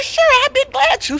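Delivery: high, loud friendly reply; friendly fox. Acted. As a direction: answer brightly as a sly fox. Fox agrees.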